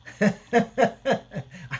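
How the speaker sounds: short laugh. Real, not acted. To viewers after a sly remark, short laugh. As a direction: laugh it off at the joke.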